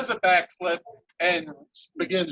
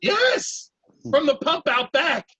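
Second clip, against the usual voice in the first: 1 cheery NPC; 6 semitones higher, 3 dB louder, slower than usual. Acted. GM voices NPC answering.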